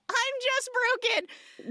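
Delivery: sing-song high mockery of the sappy dad line; mock high repeat. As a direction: say "broken" again way up high and slow, mocking the line. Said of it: "He says".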